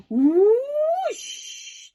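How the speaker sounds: loud high whooshing rocket sound. A narrator's reading. Narrator voicing the rocket blasting off, loud whoosh. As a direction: make the rocket whoosh past.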